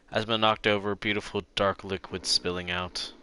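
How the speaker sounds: calm narrator read of game text; game narration read. Acted. Streamer reading the detective game text aloud, calm narration. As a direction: read the scene to viewers, calm and even.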